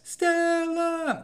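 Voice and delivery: stretched, very slow high yell of Stella; Stella bellow. Shown as a demonstration. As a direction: howl Stella like Brando in the street. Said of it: "reference to the play in the movie".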